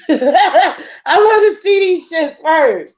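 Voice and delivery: high, slow words through real laughter; giggling while you talk; laughing. Real, not acted. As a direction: laugh your way through the line. Laughs at her own words.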